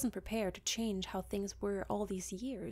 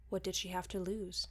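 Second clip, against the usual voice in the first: usual speaking voice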